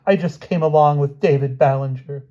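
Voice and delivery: high, loud frightened plea; desperate high-pitched pleading; begging. A narrator's reading. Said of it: "she begged".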